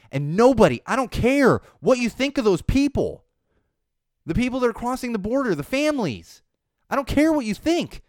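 heated outrage; angry rant. Real, not acted. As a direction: shout down anyone who disagrees.

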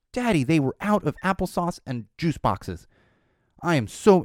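high-pitched imitation of an upset child; upset daughter. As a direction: mimic a little kid's indignant complaint. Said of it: "she's real upset".

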